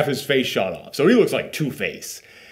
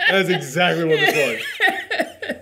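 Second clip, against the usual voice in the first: laughing; 4 semitones higher than usual. Real, not acted. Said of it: "laughing agreement".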